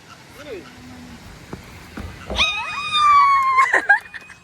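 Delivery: high screaming laughter; shrieking laugh. Real, not acted. Friend completes the jump.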